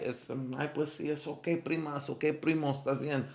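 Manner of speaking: hushed, placating Spanish aside; soothing aside. Acted. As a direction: gently let a cousin off the hook. Soothing relatives after party mishaps.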